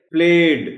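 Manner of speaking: प्लेड with long vowel, voiced D finish; light D ending. Shown as a demonstration. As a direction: let प्लेड close on a slight D. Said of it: "हल्की सी डी की आवाज".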